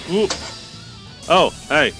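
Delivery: ordinary talk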